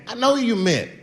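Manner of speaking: ordinary talk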